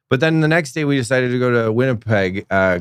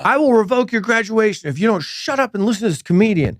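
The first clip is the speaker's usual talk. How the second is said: high-pitched, exasperated school principal; mock-stern reprimand, pitched way up; scolding principal; 8 semitones higher than usual. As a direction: imitate a principal threatening unruly students. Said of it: "the principal comes up and grabs my microphone".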